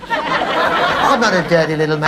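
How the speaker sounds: put-on high, indignant old codger; cheeky old man. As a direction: defend yourself like a grubby old man. Sketch, old father replies.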